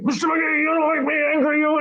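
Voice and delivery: high, straining angry actor voice; playing it angry. Actor threatening McGee in the first take, high and angry. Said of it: "he was playing it angry".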